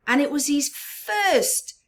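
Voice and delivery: usual speaking voice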